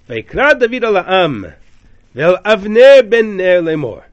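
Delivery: loud, high, booming call across the valley. Retold or imitated. David calling out to the sleeping camp, booming. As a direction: boom it out from the hilltop. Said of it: "booming voice".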